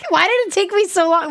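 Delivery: high, laughing exasperation at oneself; amused self-mocking squeal. Real, not acted. Laughing at own brain fart.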